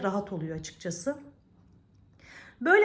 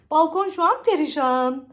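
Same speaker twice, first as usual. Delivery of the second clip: laughing; 6 semitones higher, 9 dB louder than usual. Real, not acted. Laughing at her messy balcony.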